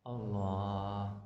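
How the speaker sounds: low, soft, drawn-out answer; hushed reply in a teaching role-play; reverent answer. Shown as a demonstration. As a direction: answer quietly, stretching the word. Answering as the taught child.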